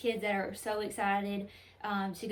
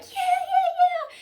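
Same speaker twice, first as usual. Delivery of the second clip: cheering elves; 10 dB louder, much slower than usual. Retold or imitated. Elves cheering as Santa appears.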